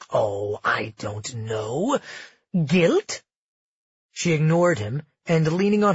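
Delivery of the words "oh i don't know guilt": slow, low mock musing; drawling taunt. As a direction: drag it out, then jab. Felix needles her.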